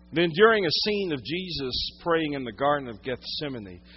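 ordinary talk, nothing marked

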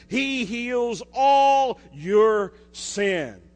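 "all" drawn out and punched, slow and loud; stress on all. Acted. Pastor driving home the verse to his church, slow stress on "all". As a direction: lean hard on "all", slowly.

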